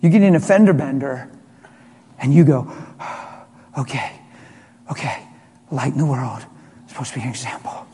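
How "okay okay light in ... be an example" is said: soft, low self-talk; acted, hushed attempt at composure; forced self-calming. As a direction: talk yourself down under your breath. Forcing calm after a fender bender.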